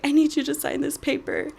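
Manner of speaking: mock a plea through tears; imitated crying. Student asking favorite professor for a signature, imitated crying. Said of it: "I just started bawling, like, crying".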